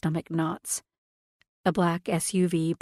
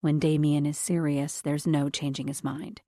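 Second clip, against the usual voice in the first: ordinary delivery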